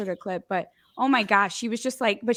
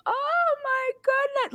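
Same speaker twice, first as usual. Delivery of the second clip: much higher, slow, wailing mock despair; dramatic crying; slower than usual. Mother imitates her daughter's overdramatic crying after a simple no. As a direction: overdo the heartbreak like a tiny diva. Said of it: "like, like, crying".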